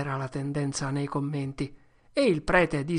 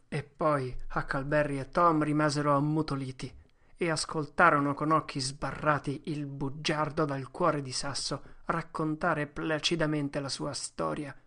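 plain reading voice

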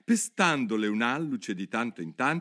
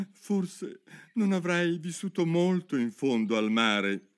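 higher, slow, tearful turtle voice; in lacrime; 6 semitones higher than usual. A narrator's reading. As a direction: speak slowly through the last of the sobs. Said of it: "con le guance rigate di pianto". (voce lamentosa e piagnucolosa)

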